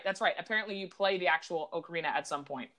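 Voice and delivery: ordinary talk, nothing marked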